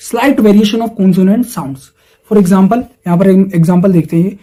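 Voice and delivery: ordinary talk, nothing marked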